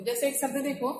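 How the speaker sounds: normal talking voice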